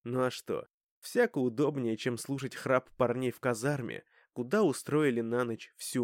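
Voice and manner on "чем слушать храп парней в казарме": plain reading voice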